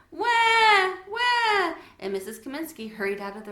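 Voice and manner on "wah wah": put-on loud, high baby crying wah; baby wail. A narrator's reading. Baby brother wails at bedtime, loud and high. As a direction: wail like a newborn. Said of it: "Timothy went".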